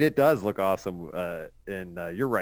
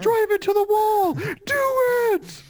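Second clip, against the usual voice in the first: high, loud, crazed driver's yell; reckless yell; 6 dB louder than usual. Acted. Joking about the reckless driver to friends, high reckless yell. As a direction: scream it like a meth-fuelled daredevil.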